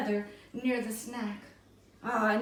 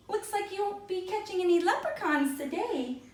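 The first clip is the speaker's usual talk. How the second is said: lightly voiced Irish leprechaun, a touch higher; mocking leprechaun; 8 semitones higher than usual. A narrator's reading. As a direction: tease them in a little Irish voice. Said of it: "mocked a little Irish voice".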